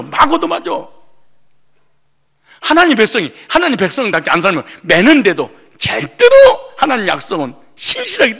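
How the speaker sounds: loud, driving sermon delivery; forceful preaching. Acted. Preacher thunders about God's discipline, loud preaching. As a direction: preach it hard to the congregation.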